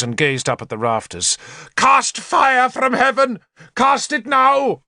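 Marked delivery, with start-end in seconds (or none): 1.8-4.8 high, slow declaimed cry; frantic invocation. A narrator's reading. Bishop on his knees calling to God, high frantic cry. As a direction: call down heaven, stretching the words.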